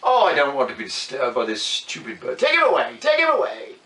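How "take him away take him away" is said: high, loud royal command. Acted. King ordering his soldiers, loud and high. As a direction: order them off impatiently.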